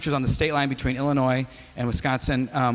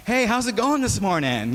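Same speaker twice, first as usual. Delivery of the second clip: bright, much higher barista hello; overly chipper greeting; 8 semitones higher than usual. Retold or imitated. New barista greets first customer, chipper and high. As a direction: play it super cheerful at dawn. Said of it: "being super six o'clock in the morning-ish".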